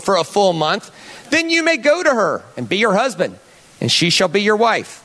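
sing-song Southern scripture reading; Southern accent. Acted. Pastor reading Deuteronomy twenty-one to the room, Southern accent. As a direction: read it out like a Southern preacher. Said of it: "in a Southern accent".

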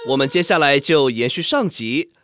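ordinary talk

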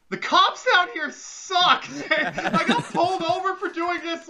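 high, slow, loud outraged yell; stretched-out high shout; mock yell. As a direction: yell it like a fed-up friend. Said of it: "And Amber's like".

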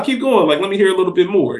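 ordinary talk

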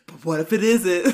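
loud, alarmed what-if; worried. Retold or imitated. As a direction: jump up in pitch and volume with worry. Acts out worry over dad's call.

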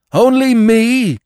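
high, loud, drawn-out cry; frenzied cry. A narrator's reading. Dog in a frenzy of devotion, high loud cry. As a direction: cry out in wild protest. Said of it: "he cried".

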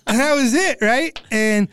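usual speaking voice

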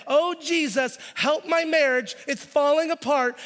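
high, loud mock begging for help; hammed-up churchgoer pleading to Jesus over money; desperate plea. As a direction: whine it like someone crying out to Jesus in a panic.

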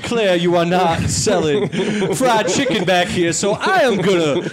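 put-on slow drawling Southern gentleman accent; hammy Old South general speech; southern belle. Playing a Southern general character.

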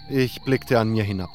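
ordinary delivery, nothing marked

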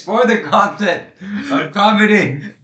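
high, slow, put-on announcer voice; mock announcer voice. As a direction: boom it out like a show intro. Mock announcer opening line.

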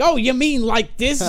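slow, high caricature of a stage persona; Gilbert Gottfried. Retold or imitated. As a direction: switch into the stage voice, high and drawn out. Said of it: "No, talk like Gilbert Gottfried".

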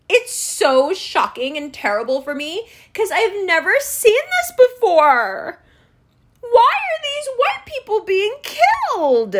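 sarcastic high-pitched shock; mock horror. Mocking the media with a hammed-up gasp of shock. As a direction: ham up fake outrage at the news.

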